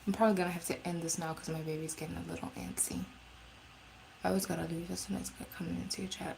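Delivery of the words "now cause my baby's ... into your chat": fast, hushed whisper. Real, not acted. Whispering to chat while the baby gets antsy.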